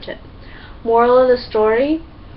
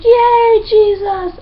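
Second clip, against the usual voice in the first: much higher, louder gleeful shout; squealing happy cheer; joyful cheer; 12 semitones higher, 9 dB louder, much slower than usual. Retold or imitated. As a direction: burst out celebrating. Israelites rejoicing when prayer answered.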